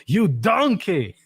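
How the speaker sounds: mock scolding a bad cook Ramsay-style; Chef Ramsay insult. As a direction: drag out the insult like an angry TV chef. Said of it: "like Chef Ramsay responds".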